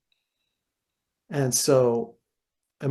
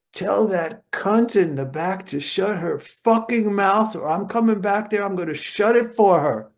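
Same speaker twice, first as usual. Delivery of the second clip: furious scream; 7 semitones higher, 3 dB louder than usual. Retold or imitated. Bandleader screaming at a chatty party guest, furious yell. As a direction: bellow it out across the room, slowing down. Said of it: "and he screams".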